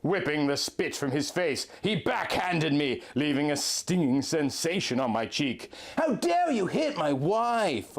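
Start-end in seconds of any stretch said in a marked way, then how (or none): 6.0-8.0 high, slow outraged yell; a husband's furious cry, hammed up; screamed. A narrator's reading. Link screaming at Dark Link for hitting his wife, high and slow. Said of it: "Link screamed".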